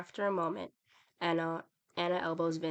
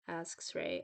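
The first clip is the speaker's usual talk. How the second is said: ordinary delivery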